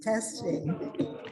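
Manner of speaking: ordinary delivery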